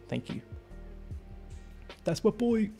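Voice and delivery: usual speaking voice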